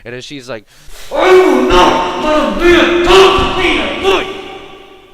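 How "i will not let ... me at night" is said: all-out high, slow mock scream; enraged screaming. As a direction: scream every word as the furious customer. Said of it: "she's, like, so fucking angry".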